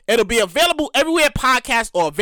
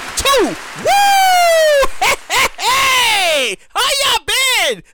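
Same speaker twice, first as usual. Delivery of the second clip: excited greeting; 11 semitones higher, 4 dB louder, much slower than usual. Real, not acted. Greeting listeners at show start.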